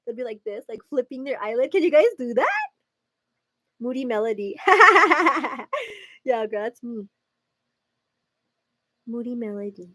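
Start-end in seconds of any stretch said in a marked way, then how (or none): 4.6-5.9 high, rapid cackle; loud laugh. Real, not acted. Laughing at her own Moody Melody nickname, high cackle. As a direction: burst out in a long high laugh.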